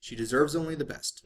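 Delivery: ordinary delivery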